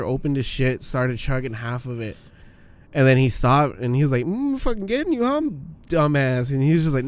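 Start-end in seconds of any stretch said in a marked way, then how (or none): 4.3-6.5 squeaky taunting voice, pitched way up; a high teasing bro; high mock voice. Dared guy voiced, high.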